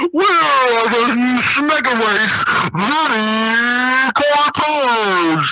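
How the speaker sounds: very slow, drawn-out holler; long, exaggerated drawl; hammed-up movie line. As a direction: stretch the words out like a slow-motion cry. Hammed-up shout retelling finish line.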